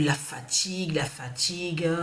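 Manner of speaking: chuchoté, à bout de forces; murmure épuisé. Retold or imitated. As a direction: souffler les mots comme vidée. Épuisée, parle de sa fatigue. (lasse, presque sans voix)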